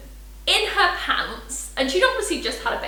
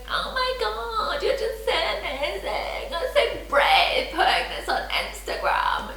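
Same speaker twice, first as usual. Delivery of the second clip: a hint of gushing fans, slow and laughing; 6 semitones higher, 3 dB quieter, slower than usual. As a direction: coo over her like an adoring follower. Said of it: "to get a reaction going".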